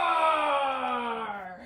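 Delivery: loud lion roar. Acted. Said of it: "and said".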